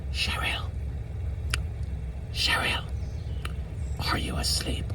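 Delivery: unvoiced whisper. Grandma waking her sister beside a stranger, hushed whisper. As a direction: whisper it so you don't wake the man. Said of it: "she says to her sister".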